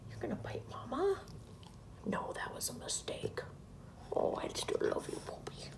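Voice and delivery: soft whispered pet talk; hushed loving whisper; whispering to dog. Real, not acted. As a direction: whisper tenderly to the puppy.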